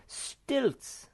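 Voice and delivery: high triumphant answer; eager Snufkin. A narrator's reading. Wanderer cries out his idea, high. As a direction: announce the idea. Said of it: "he cried".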